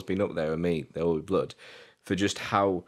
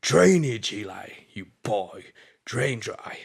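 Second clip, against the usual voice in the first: Daniel's tirade; 3 semitones higher, much slower than usual. Acted. Acting out Daniel's breakdown at Eli, taunting. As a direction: stretch the first word up high, then slow right down. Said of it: "he goes like this".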